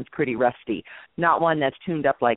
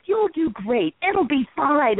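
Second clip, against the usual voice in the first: reassuring; 8 semitones higher, slower than usual. Retold or imitated. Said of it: "And then I started laughing".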